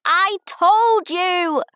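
high, loud, long-drawn shout; indignant high-pitched shout, stretched out; child's shout. A narrator's reading. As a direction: holler it triumphantly like a little girl. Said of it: "Pip shouted".

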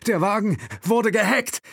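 high, loud alarmed realisation; alarmed cry. A narrator's reading. Will realises the car is hacked, high and loud. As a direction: blurt it out in shock. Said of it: "stammelte Will".

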